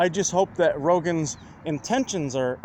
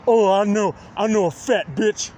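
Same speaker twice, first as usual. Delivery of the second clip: mocking douchebags; 3 semitones higher, 3 dB louder than usual. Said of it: "these douchebags are like".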